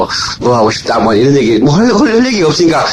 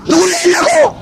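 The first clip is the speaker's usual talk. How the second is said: much higher, mocking yell; mocking shout; 12 semitones higher than usual. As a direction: hurl the jab at the doubters. Preacher mocks, shouting rebuke. (비웃듯 높게 외치기)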